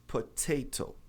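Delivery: "potato" with both Ts crisply pronounced; British T. Shown as a demonstration. Teacher giving the British pronunciation, both Ts sounded. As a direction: say "potato" keeping each T a true T. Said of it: "Essa é a pronúncia do britânico".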